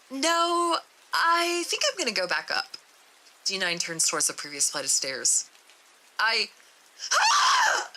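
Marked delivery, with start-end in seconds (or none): 0.1-2.8 scared refusal. Acted. D-9 refuses to continue. 7.1-7.9 very high, loud shriek of fright; terrified scream. Acted. The face appears behind D-9.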